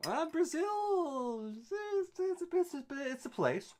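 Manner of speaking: very high, slow, dodging the question; squeaky evasive voice. Acted. Streamer dodging a chat question about Brazil, very high squeaky voice. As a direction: squeak it out like you'd rather not say.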